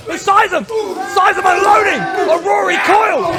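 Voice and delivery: high, loud hype call; play-by-play shout. Acted. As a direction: roar the entrance like a commentator.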